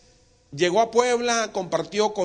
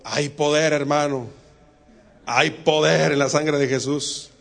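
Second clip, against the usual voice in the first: loud slogan; 2 semitones lower, 9 dB louder, slower than usual. Acted. Chanito dodges with a slogan.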